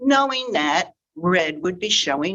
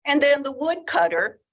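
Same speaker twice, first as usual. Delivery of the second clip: plain reading voice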